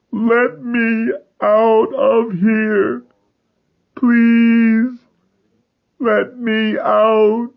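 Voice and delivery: slow high crying scream. Acted. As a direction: wail to be let out. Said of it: "Thomas screamed at first".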